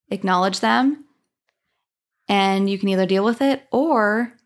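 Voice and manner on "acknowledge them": normal talking voice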